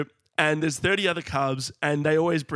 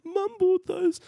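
much higher, imitating his child self realising; mock astonishment. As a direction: gasp it out high as the penny drops. Kid discovering Christmas gifts.

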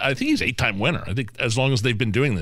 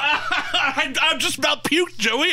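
shout; 13 semitones higher, much slower than usual. Real, not acted. After gagging on shrimp.

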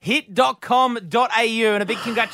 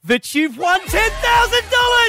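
excited announcement; 5 dB louder than usual. Real, not acted. Announcing a prize win.

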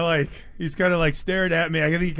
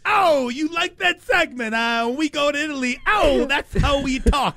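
playing the Italian role; Italian accent; 8 semitones higher than usual. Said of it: "And I was like".

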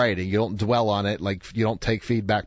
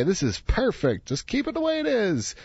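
imagined teacher; 11 semitones higher than usual. Pretend. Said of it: "the teacher's gonna say".

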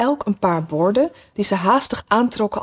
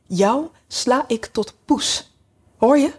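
mock threat; 4 semitones higher, slower than usual. A narrator's reading. Alice threatening the Red Queen.

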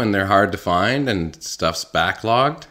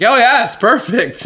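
high, eager fake seller voice, way up; hammed-up pushy seller praising the truck; mock seller; 14 semitones higher, 5 dB louder than usual. Mocking a truck seller who oversells, high and eager.

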